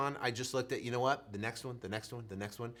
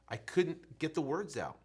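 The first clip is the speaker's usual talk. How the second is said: ordinary delivery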